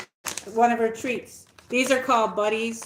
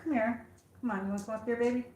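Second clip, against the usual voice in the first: softer, gentle coaxing call; coaxing the dog; 6 dB quieter, much faster than usual. Real, not acted. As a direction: coax her over softly.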